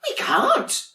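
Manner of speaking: very high, sing-song little voices; sweet sibling penguins. Acted. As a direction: coo it like cuddly little penguins. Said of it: "came the reply".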